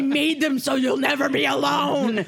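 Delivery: half-laughing hammed-up shout; mock desperate shouting. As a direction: shout it at the kids, laughing through it. Imagined outburst at her kids.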